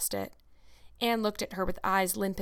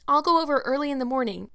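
put-on higher, quick-to-offer girlish voice; eager; 6 semitones higher, 6 dB louder than usual. A narrator's reading. Anne bargains with Marilla to wait, eagerly. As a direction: offer it eagerly, pitch raised. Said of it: "said Anne eagerly".

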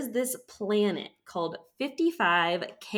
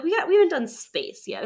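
mock eager self; 9 semitones higher, 5 dB louder than usual. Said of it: "I was like".

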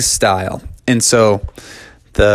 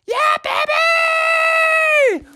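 put-on very high little-kid squeal; tiny baby cheer; faster than usual. Baby sister joining the cheer, very high little squeal. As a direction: squeak it like a toddler.

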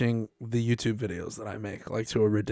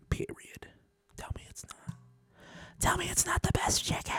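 insistent teasing whisper; 15 dB quieter than usual. Pretend. Whispering a dare to the co-host about chicken. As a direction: hiss the dare softly.

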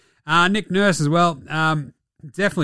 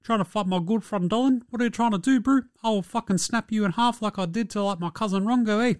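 higher, softer mock Kiwi tough guy; mock Steven Adams; 7 semitones higher, 3 dB quieter than usual. Steven Adams defending his teammate, in a put-on Kiwi accent. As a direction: threaten in a put-on New Zealand brogue. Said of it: "Steven Adams".

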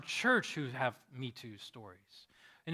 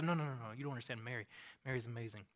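soft, low, gentle correction; hushed, lower, patient answer; calm reassurance; 4 semitones lower, 9 dB quieter, faster than usual. Acted. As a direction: quietly reassure the worried scribe. Matthew calmly reassures the scribe.

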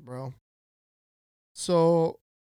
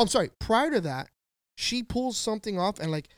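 ordinary delivery

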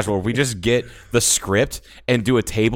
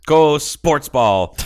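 mock cheer; 5 semitones higher, 6 dB louder, much slower than usual. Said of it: "mock sports cheer".

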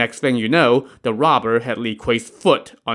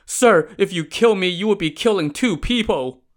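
crying out; 8 semitones higher than usual. A narrator's reading. Imposter cries out beneath the raised axe. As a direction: cry out to stop the axe. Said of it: "the imposter cried out".

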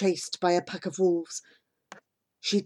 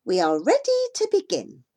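put-on crackly old voice; 8 semitones higher than usual. A narrator's reading. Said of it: "her voice as crackly as wizened winter leaves".

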